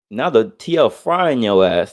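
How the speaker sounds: ordinary talk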